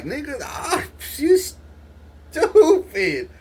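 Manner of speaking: high, loud, drawn-out laughing put-down; laughing scoff. Retold or imitated. Telling a friend in a dream he's stupid, laughing scoff. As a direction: laugh him off as an idiot. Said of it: "And I was like".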